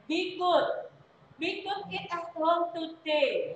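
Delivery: slow, careful English sentence, "could" with no L sound; slow model reading. Shown as a demonstration. Teacher models the corrected sentence slowly for students. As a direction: spell it out slowly for the class. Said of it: "Ya, gitu seperti itu".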